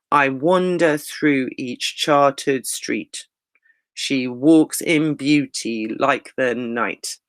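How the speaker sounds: slow, even iambic beat, each stress marked; measured walking pace. Shown as a demonstration. Teacher reciting Blake and Byron to show the meter, slow and measured. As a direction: read it at a steady walking pace, da-DUM da-DUM. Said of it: "it's measured, it's walking pace".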